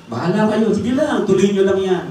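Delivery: high, loud sarcastic go-ahead; mocking dismissal. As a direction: taunt them to carry on. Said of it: "sabi niya". (sarkastikong pagpapabaya)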